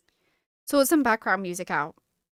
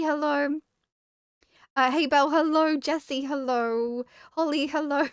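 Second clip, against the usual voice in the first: normal talking voice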